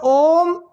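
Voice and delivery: ओम stretched out on one tone; prolonged pluta vowel. Shown as a demonstration. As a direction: hold the vowel of ओम extra long. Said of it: "तत्र प्लुतस्वराः".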